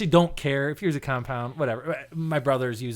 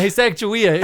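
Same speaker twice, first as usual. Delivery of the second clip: loud, higher acted greeting; calling out; 5 semitones higher, 6 dB louder than usual. Worker walking over to greet coworker, calling out. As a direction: hail him across the room.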